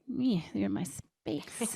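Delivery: slow, soft grumble; mock grumpy. Recalling her first reaction to her partner, a soft mock grumble. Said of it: "At first I was like".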